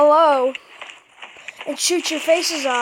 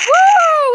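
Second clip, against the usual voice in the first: high, loud celebratory woo; triumphant whoop; 11 semitones higher, 7 dB louder than usual. Real, not acted. Player cheering a kill, triumphant whoop. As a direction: let out a big real whoop.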